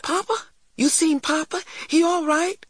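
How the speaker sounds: put-on very high, urgent child's questions; crying out anxiously. A narrator's reading. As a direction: interrupt eagerly, desperate for news. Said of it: "cried Christopher".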